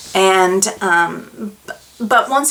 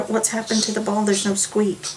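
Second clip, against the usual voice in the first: usual speaking voice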